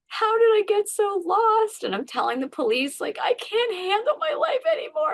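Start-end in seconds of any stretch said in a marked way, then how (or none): 0.1-1.8 high, loud, tearful wail; crying out. Retold or imitated. Woman in her car crying out in despair. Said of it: "then I'm crying and I'm like". 3.2-5.1 much higher, tearful plea; crying. Retold or imitated. Woman crying to the police that she can't cope. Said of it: "And I'm crying".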